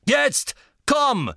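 high, loud urgent call. Acted. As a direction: call to the giant Death Eater behind. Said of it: "rief er dem riesigen Todesser". (laut und drängend rufen)

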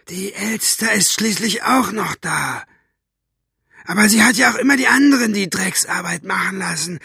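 high-pitched menacing villain voice; spiteful villain, pitched high throughout; sneering villain. A narrator's reading. Villain's dialogue in audiobook.

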